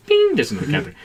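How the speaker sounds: ordinary talk, nothing marked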